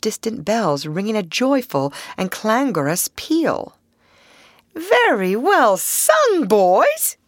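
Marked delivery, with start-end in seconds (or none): 4.7-7.2 high, slow, hearty praise; jovial, high-pitched congratulation; hearty cry. A narrator's reading. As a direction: call out your praise heartily. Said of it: "cried the Rat heartily".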